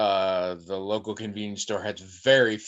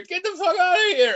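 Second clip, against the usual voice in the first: put-on much higher, heavy Greek accent warning; 5 dB louder than usual. Retelling the soldier's warning to Trish, in a heavy Greek accent. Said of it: "a voice speaking English with a heavy Greek accent".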